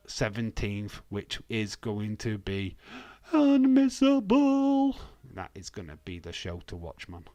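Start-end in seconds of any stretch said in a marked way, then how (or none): 3.3-5.0 loud, high, long-drawn sung-out announcement; hype announcer. Pretend. Streamer hyping June seventeenth show, drawn-out announcer call. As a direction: belt it out and stretch it like a ring announcer.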